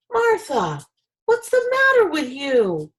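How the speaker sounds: high, loud yell at his wife. A narrator's reading. Husband yelling at his wife over raw egg, loud and high. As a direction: shout it out in alarm. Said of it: "yelled Mister Wagon Wheel".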